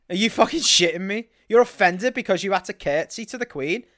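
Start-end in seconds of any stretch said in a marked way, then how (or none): none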